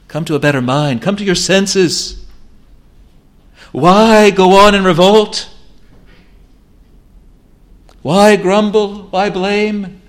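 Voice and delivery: loud, high, urgent exhortation; preaching at full force. Acted. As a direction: drive every phrase home, slowing on the commands.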